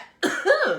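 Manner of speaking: sudden high cough, acted out; imitated cough. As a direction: replay the cough from nowhere. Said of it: "I'm like".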